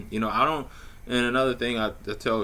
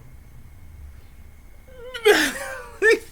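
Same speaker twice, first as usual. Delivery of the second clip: squealing laugh, much higher; high excited laugh. Real, not acted. Reacting to the invitation.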